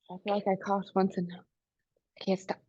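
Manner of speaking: soft whispered aside; whispering. Real, not acted. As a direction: whisper it after a coughing fit. Aside after coughing fit.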